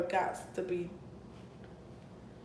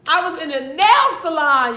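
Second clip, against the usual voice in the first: loud, high, angry outburst; real fury bursting out; furious; 7 semitones higher, 13 dB louder than usual. Real, not acted. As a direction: boil over with rage at the salon.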